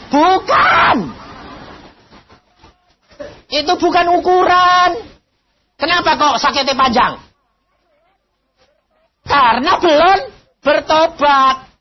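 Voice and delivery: high, loud emphatic preaching; forceful preaching. Acted. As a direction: hammer home the point to the congregation. (khotbah keras dan tegas)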